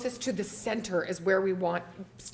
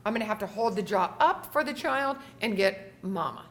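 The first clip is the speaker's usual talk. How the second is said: usual speaking voice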